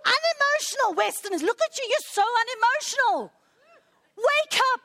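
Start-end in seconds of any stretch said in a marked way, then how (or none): 4.2-4.8 high, loud wake-up call; rousing call. Real, not acted. Preacher calling his flock to wake up, loud and high. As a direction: shake them awake.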